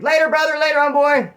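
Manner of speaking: loud, high-pitched holler; re-enacted triumphant goodbye yell; gleeful shouted farewell. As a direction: call it out across the room on your way out. Calling a goodbye while leaving.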